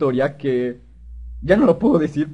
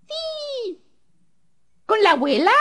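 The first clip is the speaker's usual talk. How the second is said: put-on very high falsetto child; little boy voice. Little boy asked along to grandma's, high child voice. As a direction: squeak it like an eager little kid. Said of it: "de niñito".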